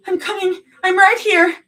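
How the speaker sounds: high, loud desperate call; frantic shout. A narrator's reading. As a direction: cry out to her girlfriend. Calling after stretcher.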